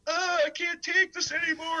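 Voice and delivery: high, strained whining voice; mock overwhelmed whine; overwhelmed stoner. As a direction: plead like it's too much to bear. Stoned, overwhelmed by loud riffs.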